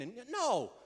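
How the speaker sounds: ordinary talk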